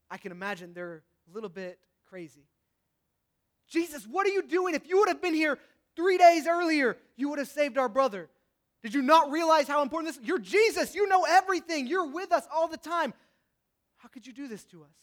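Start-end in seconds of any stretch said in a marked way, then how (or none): 3.7-13.1 high, loud anguished confronting; distraught accusation. Pretend. Sisters confront late Jesus. 14.0-15.0 much softer, deflated question; broken quiet reproach. Pretend. Sisters' final reproach.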